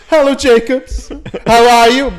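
high, loud phone greeting; hammed-up cheery phone answer; mayor on the phone.

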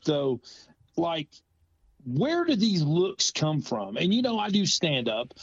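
ordinary talk, nothing marked

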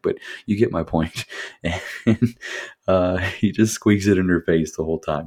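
ordinary delivery

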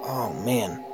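ordinary talk

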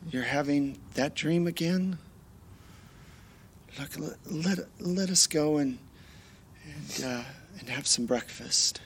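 husband gently waking wife. Acted. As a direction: soothe her out of a nightmare. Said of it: "it's your husband in the bed".

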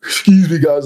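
yawning through the words; mid-yawn. Real, not acted. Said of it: "if you see me yawning".

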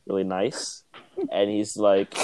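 ordinary talk

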